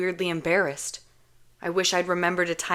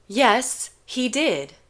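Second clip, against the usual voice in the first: determined; 6 semitones higher, 3 dB louder, much slower than usual. Acted.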